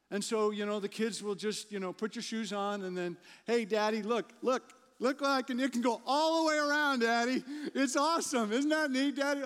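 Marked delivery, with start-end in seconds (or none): 3.5-9.4 put-on higher, louder little kid showing off; excited child. Child showing dad a trick, excited and high. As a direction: chirp it out like an eager small child.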